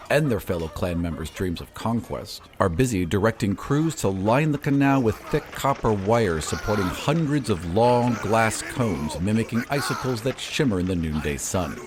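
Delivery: ordinary delivery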